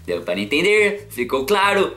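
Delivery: high, loud teasing question; playful teasing. Acted. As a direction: tease the viewers. Teasing check-in with viewers.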